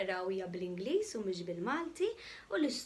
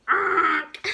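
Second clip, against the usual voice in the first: loud honk; goose honk; 4 semitones higher, 13 dB louder than usual. Acted. The goose honks loudly at Spot.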